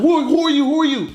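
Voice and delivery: high, insistent questioning; urgent demand. Acted. Masked attacker grabbing intruder, acted urgent demand. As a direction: fire the question out high and repeat it.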